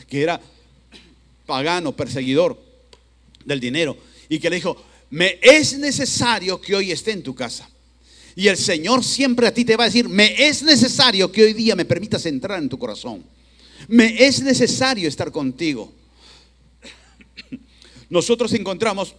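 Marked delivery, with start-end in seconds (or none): 5.1-15.9 loud, high, emphatic divine appeal; insistent preaching. Acted. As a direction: proclaim it urgently to the congregation. Preaches the Lord's insistent words.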